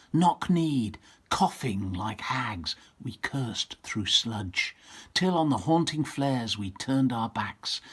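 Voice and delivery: slow, weighty recitation of a war poem; slow dramatic poetry reading. Acted. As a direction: read it grave and heavy, leaning on each image. Reading Owen's poem aloud.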